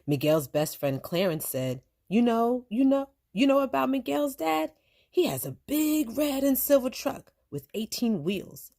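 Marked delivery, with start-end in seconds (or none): 2.1-8.7 put-on high, eager child's voice; eager boy. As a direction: brag like an excited kid. Child Clarence bragging.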